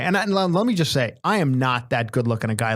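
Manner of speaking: ordinary delivery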